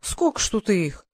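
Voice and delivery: breathless wonder, slowing down; mildly awed soldier; amazed whistle. A narrator's reading. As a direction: gasp at the pile of coins. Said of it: "присвистнул запыхавшийся Михайлов".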